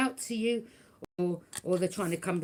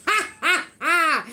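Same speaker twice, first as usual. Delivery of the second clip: mocking laugh; 8 semitones higher, 8 dB louder, slower than usual. Real, not acted. Laughing at the haters.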